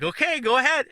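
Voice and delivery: high, pushed okay; patient on the table urging the nervous student; impatient go-ahead. Retold or imitated. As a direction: hurry him along, high and eager. Impatient reply during the shot.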